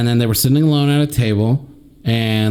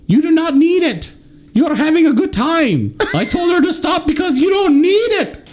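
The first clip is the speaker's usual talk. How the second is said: mock scolding friend. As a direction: play the friend scolding her while laughing. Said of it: "I did tell her".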